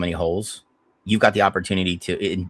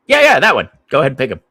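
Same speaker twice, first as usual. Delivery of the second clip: mock impatient owner, louder and higher; Al Davis; 6 semitones higher, 9 dB louder, much faster than usual. Owner insists his GM take the kicker, loud and impatient. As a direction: push the pick through, loud and insistent. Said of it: "Nothing, Mister Davis".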